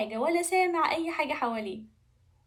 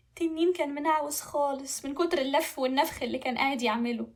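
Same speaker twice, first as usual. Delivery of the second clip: plain reading voice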